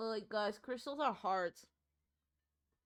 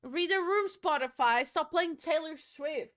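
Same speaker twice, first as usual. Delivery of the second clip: loud exasperated call; yelling; 5 semitones higher, 11 dB louder than usual. Real, not acted. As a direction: shout it at the speaker. Yelling at Spotify playlist.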